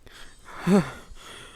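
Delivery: long breathy sighs of exhaustion; tired exhale; weary sigh. Acted. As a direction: sigh heavily, worn out. Acting tired character at desk.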